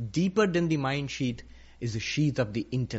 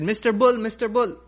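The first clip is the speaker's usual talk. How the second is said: higher, louder call to the bull; mosquito calling out; 5 semitones higher, 5 dB louder than usual. Acted. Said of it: "And said".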